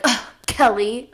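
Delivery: mock disdain.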